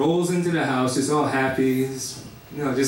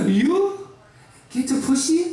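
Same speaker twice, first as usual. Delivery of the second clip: high mocking disbelief as his Korean mother; mocking mom; 7 semitones higher than usual. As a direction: sneer the question like his mom. Mother mocking son again.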